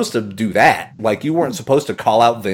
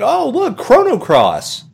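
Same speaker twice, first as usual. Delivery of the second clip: mock excited high voice; 13 semitones higher, 3 dB louder, slower than usual. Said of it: "I'm like".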